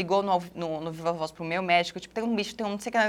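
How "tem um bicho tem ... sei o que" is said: usual speaking voice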